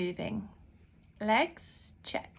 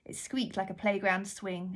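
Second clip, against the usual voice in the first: usual speaking voice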